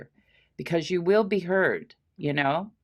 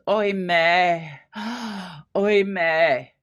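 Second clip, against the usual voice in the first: held-back grief; 4 dB louder, much slower than usual. Shown as a demonstration. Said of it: "she's gonna break down maybe, but she doesn't".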